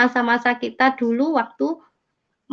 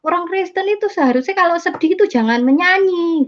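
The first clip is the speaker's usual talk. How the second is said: disapproving relatives; 8 semitones higher than usual. Retold or imitated. Family voiced disapproving.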